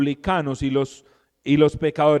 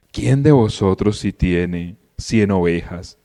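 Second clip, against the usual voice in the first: much lower, slow and solemn; solemn Lord; 10 semitones lower, 4 dB louder, slower than usual. Retold or imitated. Preacher imitating the Lord's solemn reply, low and slow. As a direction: intone it like the Lord answering grumblers calmly. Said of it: "el tono del Señor así todo solemne".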